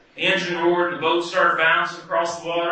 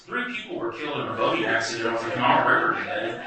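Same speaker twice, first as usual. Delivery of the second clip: fast, lower mock news read; newscaster voice; 7 semitones lower, faster than usual. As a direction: read it like a TV anchor. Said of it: "I did my fake newscaster voice".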